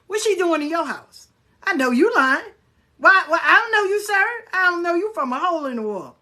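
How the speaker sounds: indignant acted-out grilling; incredulous challenge. Acted challenge to lying stranger.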